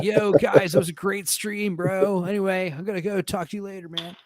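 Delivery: lightly voiced surfer dude. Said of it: "he talks like a surfer dude".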